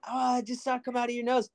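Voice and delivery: high, quick mom voice; lightly voiced fussing mother; imitating mom. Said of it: "My mom's like".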